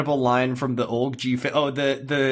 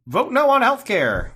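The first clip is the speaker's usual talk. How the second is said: high, slightly louder mock vote call; puppeteered senator; 10 semitones higher, 4 dB louder than usual. Mocking dead senators puppeteered into voting, in a high mock voice. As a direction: voice a senator worked like a puppet, pitched up.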